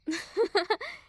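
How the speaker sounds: short, loud rising laugh; a bright little teasing laugh; amused laugh. Acted. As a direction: laugh at how cute it is. Bully amused by the parents.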